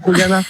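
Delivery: Juliana with a throaty Spanish J; Mexican pronunciation. Real, not acted. Caller owning up to his slip, Mexican pronunciation. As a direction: say Juliana as "Khouliana". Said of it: "j'allais dire Juliana".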